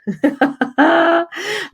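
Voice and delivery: long, high burst of laughter; real laugh. Real, not acted. Amused by Mary's comment.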